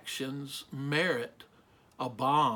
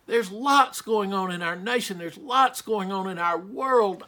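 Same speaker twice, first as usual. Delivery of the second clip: normal talking voice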